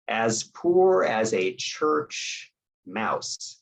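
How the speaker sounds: very slow "As poor as a church", held before "mouse"; fill-in-the-blank prompt. Shown as a demonstration. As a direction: stretch each word, leaving room before "mouse". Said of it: "can often complete the blank".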